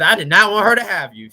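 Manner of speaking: aunt's loud quote. Retold or imitated. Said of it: "She said".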